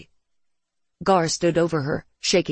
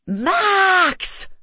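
long, high, loud scream; full-voiced scream across a distance; screamed name; 5 dB louder than usual. A narrator's reading. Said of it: "she screamed".

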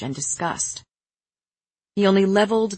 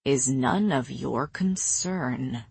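low, slow, softer warning; quiet, measured menace; too quiet; 6 semitones lower, 5 dB quieter, slower than usual. A narrator's reading. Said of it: "Cassian said too quietly".

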